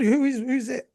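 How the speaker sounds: high, quick confused question; startled student. Retold or imitated. Students startled by the sensei's shout, confused. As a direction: look round startled.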